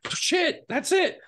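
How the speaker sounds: high-pitched imitation of a thrilled director; excited approval. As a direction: cry out as if the search is over. Director delighted by the accent.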